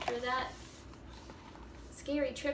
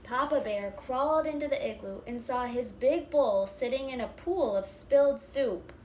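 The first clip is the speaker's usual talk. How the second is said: lively, louder read-aloud with bear voices; animated storybook reading; 6 dB louder than usual. A narrator's reading. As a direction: act it out for the first graders. Reading picture book aloud.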